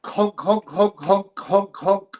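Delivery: high honking clown noises; silly clown. Pretend. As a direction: clown horn honks, high. Clown honk mockery.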